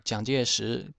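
second 蒋介石 as "tsiunn kài-si̍k"; Hokkien reading. Shown as a demonstration. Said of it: "蒋介石念成蒋介石".

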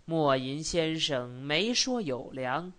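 ordinary delivery

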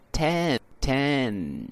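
ten said slowly, up then down; teacher isolates ten with a rise-fall. Shown as a demonstration. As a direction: stretch ten, rising then falling. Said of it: "先升后降".

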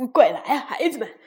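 put-on loud, laughing call to the kids; cheerful call. Father dinosaur calls the children to fresh fruit, loud and cheerful. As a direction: call them over with delight.

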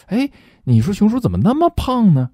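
high, curious voice; curious question. Retold or imitated. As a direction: ask it high and lively, like you're puzzled. Voicing a curious speaker.

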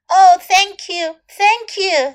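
high, loud delighted princess; cartoon princess. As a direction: gush with relief like a princess. Princess thanks the frog.

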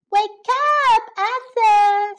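high, loud wake-up call; high childlike wake-up call; little sister waking brother. Acted. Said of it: "said D W".

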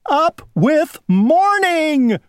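high, drawn-out rally cry; rallying shout. A narrator's reading. Beetles marching off with their signs, high rallying cry. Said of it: "shouted all the beetles".

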